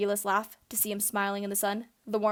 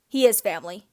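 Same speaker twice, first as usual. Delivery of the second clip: louder, hard-edged insistence; a protective friend defending Sirius, firmly raised; firm, warning tone; 8 dB louder than usual. Acted. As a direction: shut the argument down. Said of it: "in a voice that has Frank's raising his hands".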